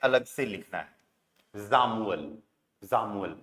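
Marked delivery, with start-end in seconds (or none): 1.7-3.3 teacher saying जामुअल; German pronunciation. Shown as a demonstration. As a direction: model the word जामुअल twice for the class. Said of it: "pronunciation सही पता चल जाए".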